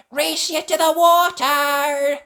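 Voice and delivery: put-on high, loud child's race call; shouted. A narrator's reading. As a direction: call out eagerly, racing to the water. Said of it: "shouted Topsy".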